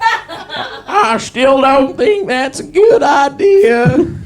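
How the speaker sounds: high character voice. Acted. Character voice, laughing.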